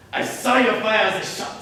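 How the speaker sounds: loud, pointed accusation; forceful accusation. Acted. Pierre accusing the woman of the shooting, forceful accusation. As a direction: press the charge home loudly. Blackmailer makes his accusation.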